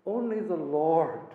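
ordinary delivery

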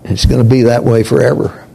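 ordinary talk, nothing marked

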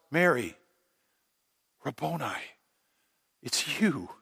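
soft, awed whisper of a name; quiet, tender wonder at seeing him again; hushed recognition. Acted. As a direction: say it as if you hardly dare believe. Mary recognizes the risen Jesus.

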